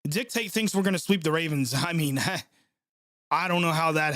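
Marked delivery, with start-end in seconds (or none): none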